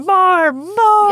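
high, very loud acted scream; Kylo screaming. Podcaster as Kylo ordering his army to fire, screaming. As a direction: scream the order at full volume. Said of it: "Kylo's screaming".

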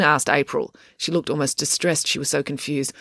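usual speaking voice

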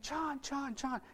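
high, softened "Chon" calls; small, high voice repeating the name as "Chon"; imitated child's calling. Little boy calling to his sponsor as he runs up, imitated calling. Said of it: "running down a dirt hill yelling".

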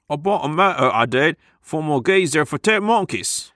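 hammed-up Cockney, pitched high; Cockney accent. Friend bragging about a new motor, over-the-top Cockney accent. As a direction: go overboard with Cockney slang sound. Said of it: "You're spiraling into Cockney accent land".

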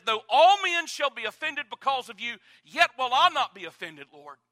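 loud, high, proud declaration; Peter's bold vow. Retold or imitated. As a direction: swear it loud to the Lord. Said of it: "Simon Peter looked at Jesus and he said".

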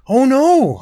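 high, loud wail of dismay. A narrator's reading. Girl sees the cracked egg, wailing loud and high. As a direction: cry out as if your treasure just broke. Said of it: "Iori wailed".

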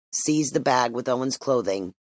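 ordinary delivery

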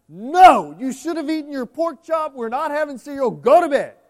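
loud, high, firm father saying no; stern refusal. Retold or imitated. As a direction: bark the refusal like a strict dad. Father refusing son, retold.

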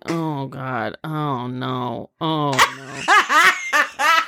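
dazed. Retold or imitated. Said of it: "was very catatonic and just kind of was like".

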